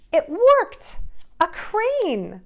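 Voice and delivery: high, loud cry of success; excited triumph. Acted. Boy finally folding the crane, shouting with joy, high and loud. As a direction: burst out with joy at the fold.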